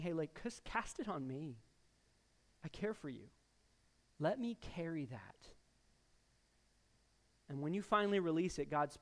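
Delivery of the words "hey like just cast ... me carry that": softer, gentle reassurance; tender God. Retold or imitated. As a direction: soothe like a father calming a child. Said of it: "God our Father is saying".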